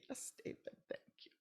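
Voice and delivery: soft unvoiced reply; quiet resigned thanks. [name] thanking David, barely voiced. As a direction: whisper the thanks. Polite reply after outburst.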